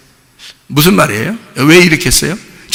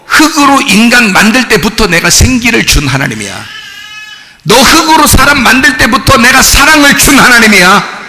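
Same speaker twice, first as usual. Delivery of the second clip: loud, high-pitched impassioned sermon as God's voice; full-force preaching; 10 semitones higher, 3 dB louder than usual. Acted. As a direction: preach it at a shout, rising in pitch. Preaches as God speaking. (하나님의 말씀으로 힘껏 외치는 설교)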